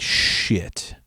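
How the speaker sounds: hushed, sinking curse; retold whisper of dread; whispered dismay. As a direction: whisper it as your luck runs out. Realises she remembers him.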